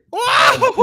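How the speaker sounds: high whooping cartoon holler, loud; Goofy. Mimicking a cartoon fall for his co-host, Goofy holler. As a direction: yell like falling down a pit, high and tumbling. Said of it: "I was thinking Goofy".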